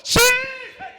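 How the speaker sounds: loud burst on the first word; eager paralytic's answer. Acted. Paralytic answering Jesus, crying out. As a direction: blurt a loud, eager yes. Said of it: "qué contestó el paralítico".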